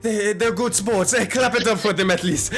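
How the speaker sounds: hurt fighter rallying a crowd's applause; injured good sport. Acted.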